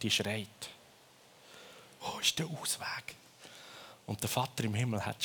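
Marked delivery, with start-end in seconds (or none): 2.0-3.2 breathy whispered plea, soft and hushed. Acted. Preacher voicing the lost world in a whisper.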